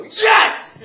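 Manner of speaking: very high, very loud gleeful yell; exultant full-blast cry; triumphant shout. Retold or imitated. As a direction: burst out in victory at the prank. Prank reveal to mother.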